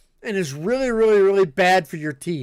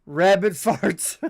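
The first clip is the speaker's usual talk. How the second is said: laughing; slower than usual. Real, not acted. Punchline through laughter.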